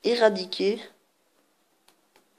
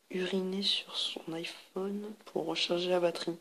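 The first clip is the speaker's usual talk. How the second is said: whisper; 8 dB quieter than usual. Acted. Reading a card.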